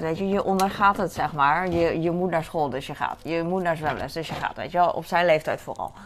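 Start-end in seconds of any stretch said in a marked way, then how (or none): none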